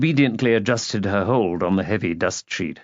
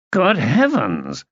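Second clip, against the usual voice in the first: slow, higher-pitched astonishment; astonished exclamation; 5 semitones higher, slower than usual. A narrator's reading. Guest seeing her on the stairs, astonished exclamation. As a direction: draw it out in amazement.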